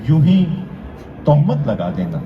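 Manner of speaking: measured declamation with pauses between phrases; mushaira-style verse recitation; poetry recital. Acted. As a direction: recite the couplet to an audience, landing each phrase.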